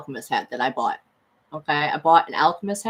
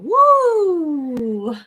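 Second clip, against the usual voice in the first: excited whoop; 10 semitones higher, 6 dB louder than usual. Real, not acted. Triumphant cheer after reveal.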